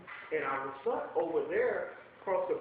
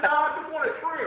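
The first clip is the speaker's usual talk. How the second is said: louder and higher, half laughing; emphatic refusal; 7 semitones higher, 9 dB louder than usual. Retold or imitated. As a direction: insist on just a trim.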